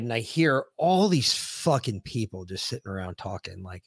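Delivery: ordinary talk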